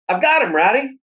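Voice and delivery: put-on high, loud boyish whoop of triumph; excited shout. A narrator's reading. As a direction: yell it out like you just caught the prize. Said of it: "I shouted".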